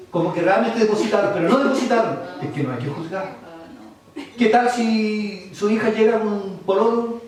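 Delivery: usual speaking voice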